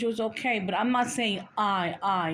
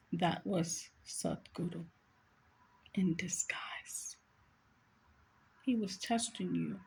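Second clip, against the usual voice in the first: soft, slow words with weighty pauses; hushed revelation; 8 dB quieter, slower than usual. Retold or imitated. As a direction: quote Jesus quietly, letting the revelation sink in. Retelling a revelation from Jesus.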